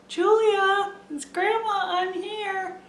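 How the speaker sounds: put-on high, carrying call to a child; grandma calling from the car; calling out the window. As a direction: call out sweetly to a toddler across the driveway. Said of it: "I yelled out the window".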